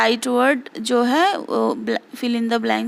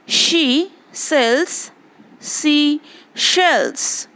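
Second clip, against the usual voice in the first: tongue twister spoken slowly and evenly; slow demo; 2 semitones higher, much slower than usual. Shown as a demonstration. Teacher demonstrates the tongue twister slowly for the class. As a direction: space the words out so pupils hear every sound. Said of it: "मैं पढ़ रही हूँ, सुनो".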